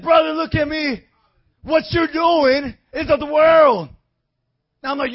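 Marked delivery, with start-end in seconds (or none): 0.0-3.9 preaching shout. Retold or imitated. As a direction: plead with him loudly.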